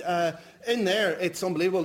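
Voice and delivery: normal talking voice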